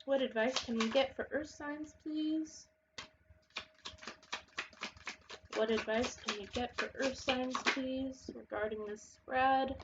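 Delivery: soft, measured request to the cards; card-reading invocation. Real, not acted. Tarot reader asking the cards for Earth signs' advice, soft invocation. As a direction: ask softly, drawing out the last words. Asks the cards while shuffling.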